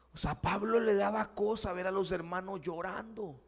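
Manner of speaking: normal talking voice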